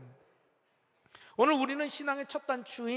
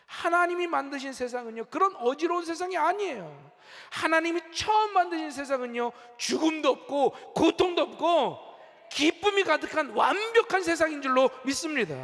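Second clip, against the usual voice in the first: emphatic, raised preaching voice; forceful preaching; 5 semitones higher, 5 dB louder than usual. Real, not acted. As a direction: proclaim it with mounting conviction.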